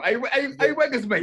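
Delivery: high, loud put-on voice to rib a mate; teasing a friend over his wine, high and loud; mock teasing voice. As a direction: hype him up in a squeaky mock voice. Teasing Weggers nickname.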